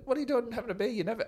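high squeaky mock Dizzy voice; high-pitched impression of a surprised mate; imitating Dizzy. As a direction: pitch it way up to play the shocked teammate. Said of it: "and go".

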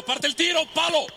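high shouted call of the shot; excited shout. Real, not acted. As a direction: shout as it hits the post. Shot hits the post.

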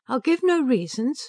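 cold and clipped refusal; icy. A narrator's reading. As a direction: ice in every word. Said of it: "she said icily".

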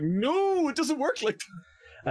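high, slow mock whine; whiny hedge fund guy. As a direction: ham up a panicking rich man.

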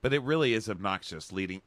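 ordinary talk, nothing marked

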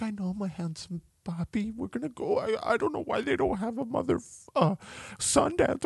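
high soft cooing mom; wife comforting son in a high coo; cooing mother. Retold or imitated. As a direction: soothe him like a doting mother. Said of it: "Pilla's like".

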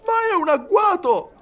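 very high, loud outcry; heated exclamation. A narrator's reading. Tipsy servant denouncing a trap, heated outcry. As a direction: cry foul, heated up by brandy. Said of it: "esclamò Passepartout, che s'accalorava".

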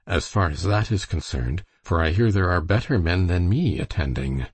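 usual speaking voice

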